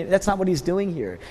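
normal talking voice

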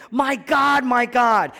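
high, loud repeated cry; anguished, full-voiced call. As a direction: throw the words up to heaven. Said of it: "Jesus Christ cries out".

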